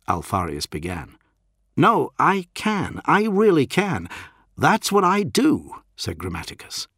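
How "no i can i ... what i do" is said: high, slow, emphatic insistence; excited insistence. A narrator's reading. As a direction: insist with rising urgency.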